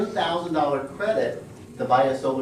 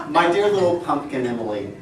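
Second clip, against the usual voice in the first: fatherly voice; 3 semitones lower than usual. Retold or imitated. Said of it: "in my most fatherly voice, almost as though Jehovah".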